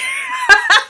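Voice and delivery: high-pitched burst of laughter. Real, not acted. Speaker catching her own strange wording, cracking up.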